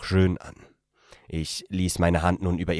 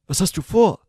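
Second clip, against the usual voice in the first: higher, louder startled question; nervous young man; 14 semitones higher, 7 dB louder than usual. A narrator's reading. The narrator asks the woman in bed, higher and louder, startled. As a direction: ask it tense and surprised. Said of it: "Meine Stimme zitterte".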